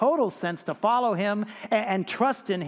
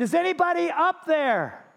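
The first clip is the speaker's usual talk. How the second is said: desperate call; 9 semitones higher than usual. Acted. Man hanging off canyon edge.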